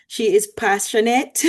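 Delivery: a light, hammed-up Trinidadian accent; bad Trini accent. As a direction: try a playful Trini lilt while reading the intro. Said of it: "That was my best bad Trini accent".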